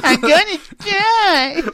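high, loud mock scream; mimicked panicked scream. Podcaster mimicking the clip just played, mock screaming. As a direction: imitate a hysterical movie line.

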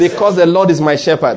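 loud, emphatic declaration; like you mean it. Shown as a demonstration. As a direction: drive it home loudly, with conviction. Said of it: "do it like you mean it".

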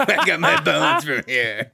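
giggling through a silly voice; laughing character voice. Pretend. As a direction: play a goofy tree, laughing. Tree character bits, laughing.

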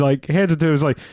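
ordinary talk

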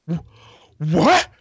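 incredulous customer. Retold or imitated. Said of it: "they're like".

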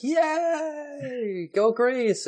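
high, drawn-out mock cheer. Greek reservists hearing war news, mock cheering. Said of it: "they're like".

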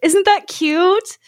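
gushing over a sweet story; cooing. Real, not acted. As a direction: coo it like it's adorable. Mike Myers' mom's bedtime stories.

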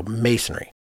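ordinary talk

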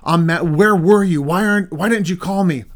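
angry demand. Pretend. Husband confronting his late wife, angry and loud. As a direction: snap back like a scared husband turned angry. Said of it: "Because it's like".

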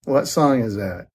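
usual speaking voice

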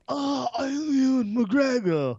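high, slow, weepy put-on voice; Ewan McGregor impression. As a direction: whine it out in a high, slow, teary voice. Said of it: "And he's like".

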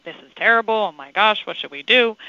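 imitating a flustered employee in a tizzy; mock panic. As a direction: manager mocking staff panicking over a problem, hammed-up. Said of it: "get on the bandwagon of".

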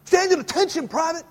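high barked command; drill sergeant order. Retold or imitated.

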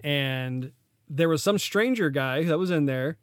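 ordinary delivery

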